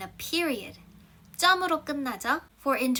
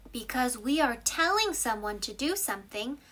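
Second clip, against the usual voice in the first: usual speaking voice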